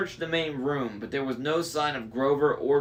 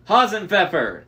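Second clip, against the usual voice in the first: a hint of a booming giant naming his sheep; fond, louder giant's greeting; Cyclops voice; 7 semitones higher, 7 dB louder than usual. A narrator's reading. Cyclops greets a sheep by name, booming. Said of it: "the Cyclops said".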